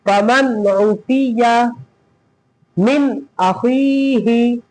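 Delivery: "फमन उफिया मिन अखीही" very slowly, vowels drawn out; slow model reading of "फमन उफिया मिन अखीही"; matan recitation. Shown as a demonstration. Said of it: "एक बार फिर पढ़ लीजिए".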